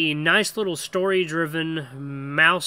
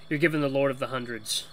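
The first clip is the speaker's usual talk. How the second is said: usual speaking voice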